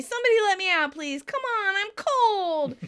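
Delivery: high, loud pleading call; calling out to be let out. Retold or imitated. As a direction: beg loudly to be let out, pitched high. Monica locked in, calling out.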